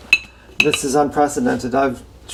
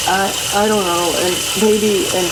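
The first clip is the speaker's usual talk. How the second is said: higher, whining I, I don't know; whiny unsure; 4 semitones higher than usual. Shown as a demonstration. Showing the viewers her own habit of whining, higher and whiny. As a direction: whine it out, unsure of yourself. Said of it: "it's like I'm whining".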